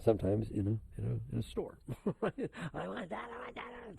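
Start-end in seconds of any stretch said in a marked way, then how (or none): 2.1-4.0 high-pitched mock toddler whine; toddler tantrum. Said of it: "they throw tantrums".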